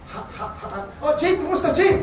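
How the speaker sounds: high, loud stuttered greeting; stuttering. Retold or imitated. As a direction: stammer nervously on the phone. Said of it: "When I'm calling".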